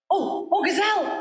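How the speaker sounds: high calling cry for the gazelle. A narrator's reading. Mouse cries out to the gazelle for help. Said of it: "he cried".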